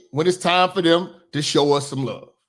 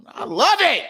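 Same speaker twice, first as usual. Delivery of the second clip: pitched-up gleeful I love it; gleeful delight; 11 semitones higher than usual. Real, not acted. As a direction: savour it like great news. Gleeful reaction to the news.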